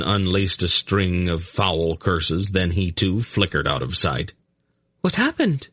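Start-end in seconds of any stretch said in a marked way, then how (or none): 5.0-5.7 high startled gasp; startled woman gasping. A narrator's reading. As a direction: gasp it out in shock, much higher. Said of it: "Zinedra gasped".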